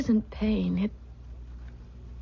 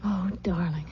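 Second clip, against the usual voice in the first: grateful whisper to her husband; tender whisper. Acted. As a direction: barely voice it, overwhelmed. Moved by husband's news.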